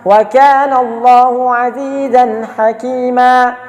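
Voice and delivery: slow chanted tajwid recitation; measured Quranic chant. Shown as a demonstration. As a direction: recite it melodically and slowly. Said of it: "cara membacanya saja seperti ini".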